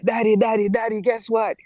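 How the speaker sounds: running down to tell his dad; excited calling. Retold or imitated. Son rushing news to father.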